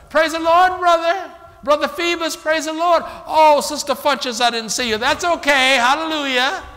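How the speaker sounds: high, loud, gushing praise-the-Lord voice; overly cheerful church greeting. Retold or imitated. Preacher mimics churchgoers gushing loud greetings. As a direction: gush greetings like a sugary churchgoer.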